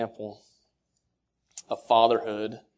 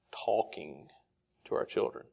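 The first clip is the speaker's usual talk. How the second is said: hushed emphasis; 4 semitones lower, 11 dB quieter than usual. Acted. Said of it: "emphasis by dropping voice".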